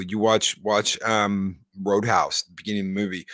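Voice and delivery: usual speaking voice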